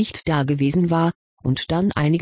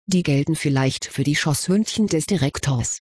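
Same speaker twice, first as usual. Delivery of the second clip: usual speaking voice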